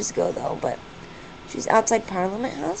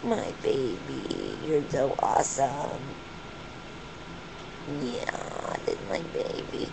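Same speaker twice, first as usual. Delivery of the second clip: low, very soft, slow cooing; tender murmur; 12 semitones lower, 8 dB quieter than usual. Real, not acted. Owner telling her bird she's awesome, tender murmur. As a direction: murmur lovingly, barely voiced.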